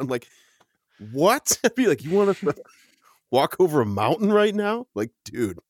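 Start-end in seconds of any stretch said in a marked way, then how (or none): 1.1-4.9 higher-pitched, disbelieving protest; mock incredulity. Soldier questioning an order to cross a mountain, incredulous. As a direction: balk in exaggerated disbelief.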